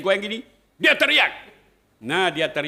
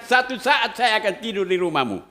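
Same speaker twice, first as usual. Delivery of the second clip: bold promise; 8 semitones higher, 7 dB louder than usual. Retold or imitated. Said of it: "Saya bilang".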